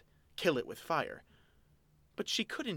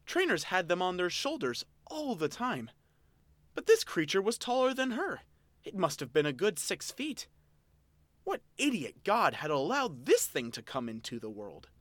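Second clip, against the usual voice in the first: louder, higher, building outrage; growing disbelief; 6 semitones higher, 5 dB louder than usual. Acted. Elaine's rising disbelief.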